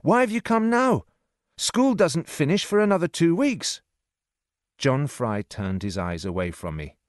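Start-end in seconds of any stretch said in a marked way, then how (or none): 0.0-3.8 high-pitched, urgent questioning; boy's cry. A narrator's reading. Boy questions servant who came early, crying out. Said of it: "I cried".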